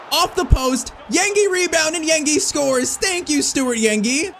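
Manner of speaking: high, drawn-out commentary on a goal; excited goal call. Real, not acted. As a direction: celebrate the rebound goal with relief, stretching the words. Scores the equalizer on a rebound.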